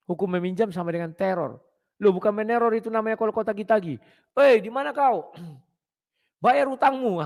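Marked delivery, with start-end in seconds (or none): 4.3-7.2 mock debt collector. Imitating a nagging debt collector.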